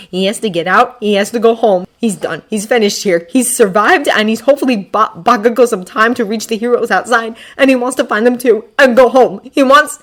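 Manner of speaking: breathless-feeling, insistent build; urgent. A narrator's reading. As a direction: pile up the thoughts in mounting urgency. Frantic thoughts of fleeing.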